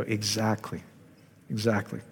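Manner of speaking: quietly agree, almost to yourself; soft agreement. Retold or imitated. Agreeing with Bill's remark.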